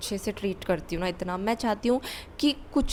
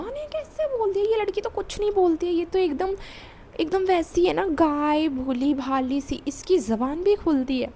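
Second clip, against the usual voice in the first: mocking girl's voice; 8 semitones higher than usual. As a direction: sneer it like she thinks you're a meek cow. Voicing the jealous girl's scorn.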